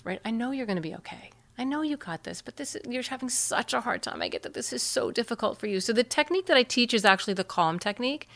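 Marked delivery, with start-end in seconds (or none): 0.2-5.8 soft, gentle reassurance, much quieter; loving reassurance. Shown as a demonstration. Parent soothing an anxious child, softly reassuring. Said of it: "from this loving, uh, place of perspective".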